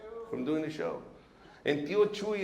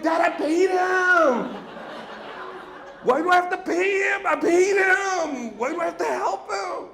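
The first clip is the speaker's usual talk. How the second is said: shouting back; 14 semitones higher, 9 dB louder than usual. Retold or imitated. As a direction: yell back in disbelief. Shouting back at angry dad.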